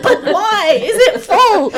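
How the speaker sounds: high, loud, exasperated retort; incredulous, laughing retelling at high pitch; exasperated questioning. Retold or imitated. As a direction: plead loudly with a clerk who won't budge. Retold argument at ticket desk.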